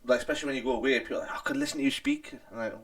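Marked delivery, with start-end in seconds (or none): 1.3-2.3 high, gushing admirer voice; swooning admirer. Retold or imitated. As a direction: coo it like a smitten fan. Quoting admirers of her accent.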